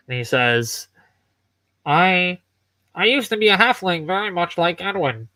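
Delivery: lightly altered metal construct voice. Acted. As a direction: ask with curious, mechanical calm. Nigel explains himself.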